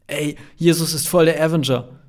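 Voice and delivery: put-on loud, high-pitched hyped teen; excited exclamation. A narrator's reading. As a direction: blurt it out, thrilled. Kevin ruft begeistert dazwischen. (begeistert herausgerufen)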